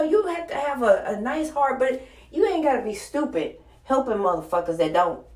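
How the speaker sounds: ordinary talk, nothing marked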